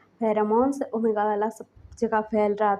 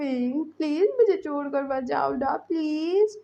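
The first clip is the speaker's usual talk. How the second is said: higher, innocent pleading; innocently; 4 semitones higher than usual. A narrator's reading. Lancen begs Wing to stay, innocent and pleading. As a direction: plead like a wide-eyed child. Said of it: "बहुत मासूमियत से बोल रहा था".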